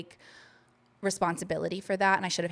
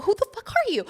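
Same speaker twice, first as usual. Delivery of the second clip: startled shout; 5 dB louder, faster than usual. Retold or imitated. Startled shout in retold scene.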